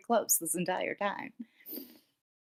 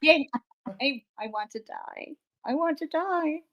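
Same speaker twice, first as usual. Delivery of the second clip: mock despair; 7 semitones higher, 4 dB quieter than usual.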